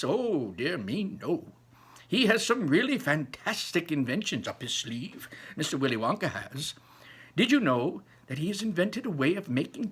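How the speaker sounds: put-on high, lively old man voice; a grandpa bursting with wonder; old grandfather. Grandpa Joe dazzling Charlie with Wonka's inventions, high and lively.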